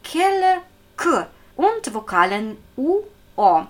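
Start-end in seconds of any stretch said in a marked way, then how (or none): none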